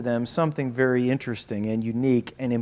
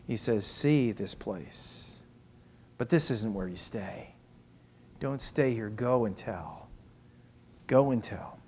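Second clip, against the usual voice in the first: hushed preaching; 2 semitones lower, 7 dB quieter, slower than usual. Real, not acted. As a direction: draw them in quietly, stretching the words.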